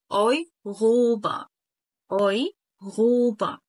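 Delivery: slow "أيغوبة" with the R as a throaty gh; uvular R pronunciation. Shown as a demonstration. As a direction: stretch "أيغوبة", R said like Arabic ghain. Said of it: "ينفع أنطق فيها حرف الآ".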